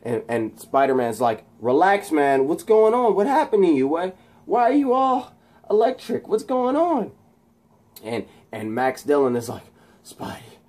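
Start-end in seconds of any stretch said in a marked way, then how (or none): 1.6-7.1 high, raised questioning voice; imitated baffled hero; acted urgent concern. Spider-Man questioning Electro. 10.0-10.6 faint, voiceless call; hushed imitation of a shaken man; acted whisper. Voices weakened Max.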